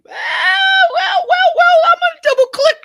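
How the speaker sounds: well well well, hammed-up high and loud; booming mock villain. Host pounces on his cohost's rebuttal, booming mock well well well. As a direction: sing out the wells like a gleeful villain.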